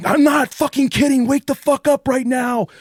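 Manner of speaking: high, loud angry yell; furious shout. Acted. TV voice screaming at the sleeping man, high and loud. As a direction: scream at him to wake. TV yells at him.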